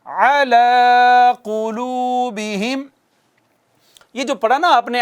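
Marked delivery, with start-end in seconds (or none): 0.0-2.8 slow recitation. Shown as a demonstration. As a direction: recite slowly for students to repeat. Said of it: "پڑھیے گا میرے بعد".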